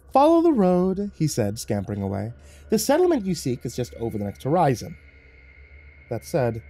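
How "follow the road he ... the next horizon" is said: high devil voice. Acted. Devil leaving.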